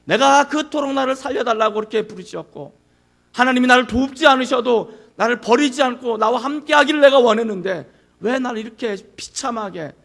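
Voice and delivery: loud, desperate cry of protest; anguished protest. Acted. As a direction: cry out as if abandoned by the Father. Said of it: "라고 항변하는 거예요".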